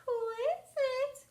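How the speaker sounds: put-on high, slow, sugary old lady; wolf putting on a sweet granny voice. Wolf in bed fakes Granny for the girl, sweetly. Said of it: "in his sweet, sweetest Granny voice".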